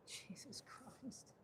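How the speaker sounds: breathy whispered Jesus Christ; whispered exasperation. Real, not acted. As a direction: mutter it under breath. Exasperated at app.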